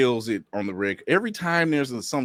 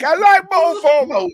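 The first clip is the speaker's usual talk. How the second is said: much higher and louder through laughter; laughing; 10 dB louder than usual. Real, not acted. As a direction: blurt it out laughing. Laughing agreement.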